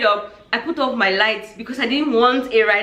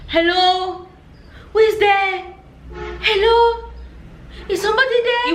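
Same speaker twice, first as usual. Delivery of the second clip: worried calling out; 10 semitones higher than usual. Retold or imitated. Sister alone in her tent at night, calling out worried. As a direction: call out nervously into the night. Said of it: "So she's like".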